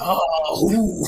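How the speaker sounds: low oh sliding into ooh; imitated prayer warm-up groans. Teasing friend about calling on spirits, imitated groaning. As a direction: mimic a long-winded sighing preamble. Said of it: "That's what she was doing".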